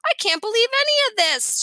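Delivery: high, dramatic wail of disbelief. A narrator's reading. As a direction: wail it like an exasperated child. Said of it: "Sheila wailed".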